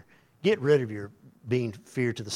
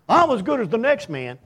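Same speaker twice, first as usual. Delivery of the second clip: high, loud mocking self-praise; mock the proud man's boast; smug boast; 12 semitones higher, 7 dB louder than usual.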